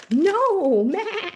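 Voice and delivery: loud high teasing no; mock no. Host teases the wrong guess, loud and high. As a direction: reject the guess like a playful game buzzer.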